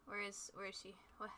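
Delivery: ordinary talk, nothing marked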